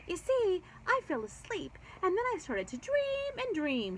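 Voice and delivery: bubbling over with her dream; chattering Gabby. A narrator's reading. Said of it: "she chattered".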